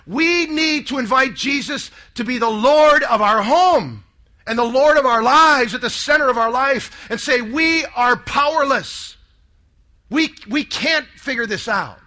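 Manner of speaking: slow, emphatic impassioned plea; fiery conviction. Retold or imitated. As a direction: retell a sister's fervent call, stretching key words. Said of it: "she's got fire in her eyes".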